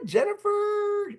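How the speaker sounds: very high, slow, stretched-out name; delighted call-out. Real, not acted. Coach cheering an attendee's answer in chat, delighted call-out. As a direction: sing out the winner's name in falsetto.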